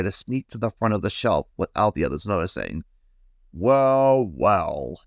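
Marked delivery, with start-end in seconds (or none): 3.5-5.0 lightly called out, drawn-out big-brush exclamation; crying out. A narrator's reading. Big brush catches the little one sneaking, mildly crying out. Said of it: "cried one of the big brushes".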